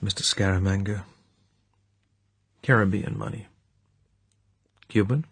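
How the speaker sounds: plain reading voice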